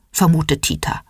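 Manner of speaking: plain reading voice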